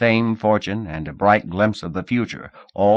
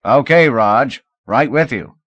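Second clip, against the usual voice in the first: raised voice down the street; calling out; 6 semitones higher, 8 dB louder than usual. Acted. Sergeant calls down the street to Raj, raised voice. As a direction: call out to a partner up the street. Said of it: "called out".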